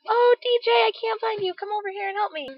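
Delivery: high pleading whine; sing-song helpless plea; whiny clingy girl. Pretend. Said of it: "So I didn't wanna be like".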